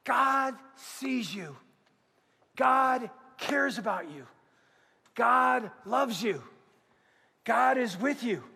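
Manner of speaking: slow, weighty declarations one by one; slow preaching. Acted. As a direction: let each line land slowly.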